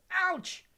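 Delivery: high, loud yelp of pain. A narrator's reading. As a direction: yelp as if suddenly poked. People poked in the face.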